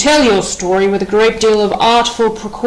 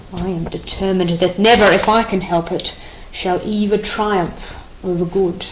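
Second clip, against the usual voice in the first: normal talking voice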